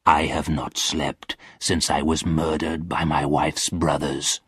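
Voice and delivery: low, soft lament; mournful ghost, pitched low; sorrowful ghost. A narrator's reading. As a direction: confess it low and heavy. Murdered ghost tells his sorrow.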